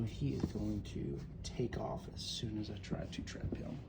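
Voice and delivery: hushed whisper while trapping the spider. Real, not acted. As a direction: whisper so the spider won't bolt. Sneaking up on spider.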